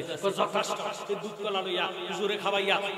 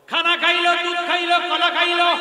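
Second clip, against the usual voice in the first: high, loud preaching cry; impassioned shout; 12 semitones higher, 12 dB louder than usual. Acted. Preacher crying out the story to the crowd, high and loud. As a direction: belt it out like a fiery preacher.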